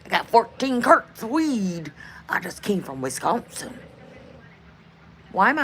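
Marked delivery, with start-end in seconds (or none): 0.1-1.9 slow put-on Southern drawl; hammed-up Southern accent, slower; mock southern drawl. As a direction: play a Southern cowboy on horseback. Mock cowboy voice, later named southern.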